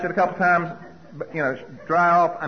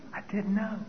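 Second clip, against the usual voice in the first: quiet aside; 5 semitones higher, 9 dB quieter than usual. Retold or imitated. Second inner confession.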